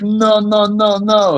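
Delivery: high mock soldier calls; a touch of the army yelling orders; soldiers shouting. Soldiers ordering him down, high mock calls. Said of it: "they're like".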